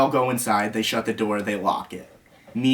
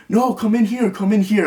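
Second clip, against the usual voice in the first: high-pitched mock urging; high, insistent retold call; imitated insistent summons; 9 semitones higher, 4 dB louder than usual. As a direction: imitate someone calling him in, pitched way up. Imitating Dan calling him inside.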